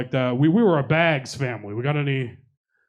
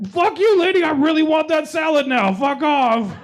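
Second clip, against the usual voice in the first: much higher, louder mock rage; mock angry outburst; 13 semitones higher, 5 dB louder than usual. Comic telling off the teriyaki shop lady, a hammed-up furious yell. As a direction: blow up at her, half laughing.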